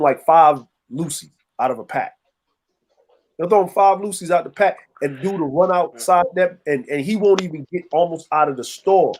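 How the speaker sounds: normal talking voice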